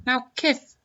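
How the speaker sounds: high, lisping boxer impression, badly faked; half-hearted high lisp; Mike Tyson. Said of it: "I don't know how to do a Mike Tyson voice".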